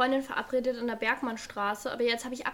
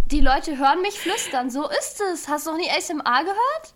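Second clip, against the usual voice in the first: louder arguing; 3 semitones higher, 8 dB louder than usual. Real, not acted. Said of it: "das ist aber viel leiser".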